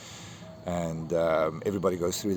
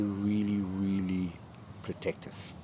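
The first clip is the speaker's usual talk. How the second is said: emotional; 6 dB quieter, slower than usual. Real, not acted. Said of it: "I get kind of emotional".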